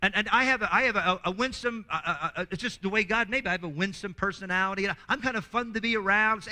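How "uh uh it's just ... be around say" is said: ordinary talk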